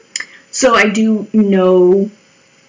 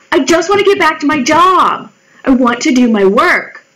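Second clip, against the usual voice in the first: higher, pleading insistence; exasperated protest; 6 semitones higher than usual. Retold or imitated. To the coworker nagging her, an exasperated plea. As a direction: push back like you're fed up.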